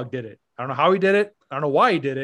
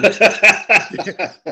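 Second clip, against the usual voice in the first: loud laugh; 4 semitones higher, 9 dB louder than usual. Real, not acted. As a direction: burst of loud real laughter. Laughing at the story.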